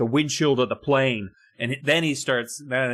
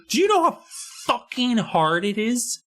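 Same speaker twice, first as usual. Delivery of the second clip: exasperated outburst; 11 semitones higher than usual. Real, not acted. Griping about igloo building.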